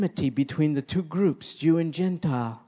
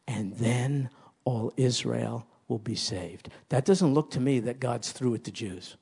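ordinary talk, nothing marked

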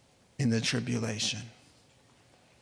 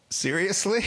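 amused incredulous God; 6 semitones higher than usual. Acted. God's one word, comic.

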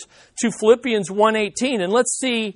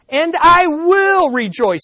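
higher, slow, emphatic "I will rejoice"; resolved rejoicing; 8 semitones higher, 4 dB louder than usual. Retold or imitated. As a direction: declare it with rising resolve. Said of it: "He kind of repeats it for himself".

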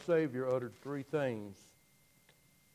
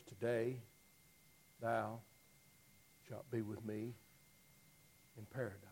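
much softer, lower, tender promise to the thief; 4 semitones lower, 10 dB quieter than usual. Retold or imitated. Jesus promising the dying thief paradise, hushed and tender. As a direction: breathe it gently like a promise.

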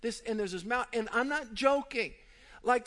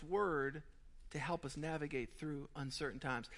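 low, soft, earnest preaching; solemn sermon; 7 semitones lower, 10 dB quieter than usual. Acted. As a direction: bring it home gently to the congregation. Preaching application turn.

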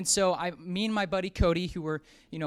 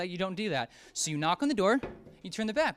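usual speaking voice

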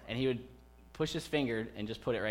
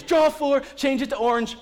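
put-on much higher and louder gloating kid's yell; triumphant shout; 14 semitones higher, 12 dB louder than usual. Young son winning at speed Uno, shouting triumphantly. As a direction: crow it right in his face. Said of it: "this is how he does it".

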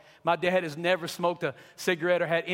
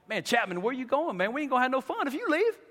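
higher, faster mocking voice of the party crowd; party buddies whining; 7 semitones higher than usual. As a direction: whine like disappointed party friends. Party friends begging him stay.

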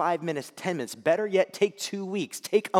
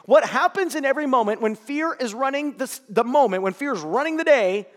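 fervent preaching; 9 semitones higher, 11 dB louder than usual. Acted. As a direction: drive the point home to the congregation. Preaching at full force.

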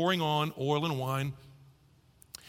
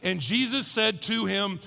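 ordinary delivery

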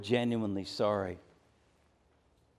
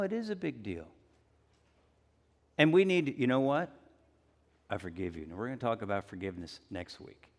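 usual speaking voice

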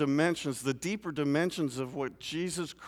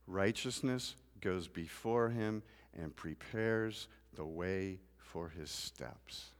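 soft, softer reading of the psalm; scripture reading; 3 semitones lower, 5 dB quieter than usual. Acted. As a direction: read the verses gently to the congregation. Reads the psalm aloud.

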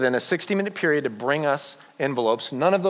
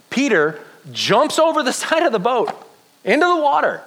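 high, swooping, amused narration; excited storytelling; 11 semitones higher than usual. A narrator's reading. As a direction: tell the leap with growing thrill. Excitedly acts out Peter's leap.

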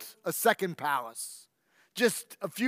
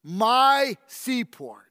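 ordinary delivery

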